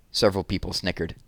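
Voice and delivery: plain reading voice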